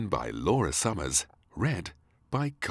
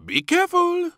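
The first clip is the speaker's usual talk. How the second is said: high, loud warning; warning chef; 12 dB louder than usual. Acted. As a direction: caution the child playfully. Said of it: "warned the chef".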